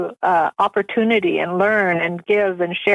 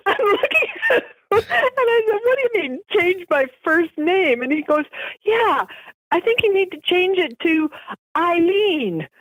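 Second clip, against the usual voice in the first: high, laughing narration; laughing while telling; 10 semitones higher than usual. Real, not acted. Retelling the husband's joke to the interviewer through laughter. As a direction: tell it, barely holding in laughter.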